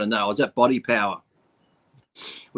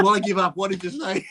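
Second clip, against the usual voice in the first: laughing through it; 6 semitones higher than usual. Retold or imitated. Retelling his own punchline.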